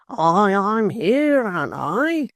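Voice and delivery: pleading to be recognised; Tappertit's voice. Acted. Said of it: "rejoined Mister Tappertit".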